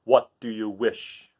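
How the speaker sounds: very slow Monkey King; a bowing beast, drawn out. Acted. Monkey King asking Dorothy's wish. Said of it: "said the Monkey King".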